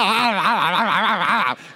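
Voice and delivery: loud mock ah-ah-ah to a tree, breaking into a laugh; venting noises. Preacher venting at a tree, mock ah sounds with a laugh. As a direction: blurt out pent-up sounds, half laughing. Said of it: "talk to a tree and just tell".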